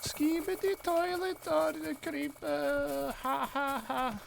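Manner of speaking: a hammed-up child voice, drawn out and high; imitating a kid. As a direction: drag the words out like a little kid, then giggle. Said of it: "the way that kid says "skibidi toilet".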